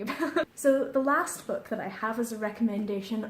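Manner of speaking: usual speaking voice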